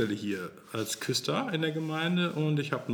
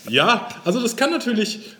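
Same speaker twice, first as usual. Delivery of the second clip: amused lift; 10 semitones higher, 9 dB louder than usual. Real, not acted. After laughing, still amused.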